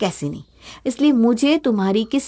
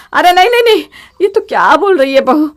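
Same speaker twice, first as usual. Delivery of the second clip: higher and louder, alarmed; panicked plea; 5 semitones higher, 6 dB louder than usual. Acted. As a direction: stop her in a rush of fear. Panicked mother-in-law stops her.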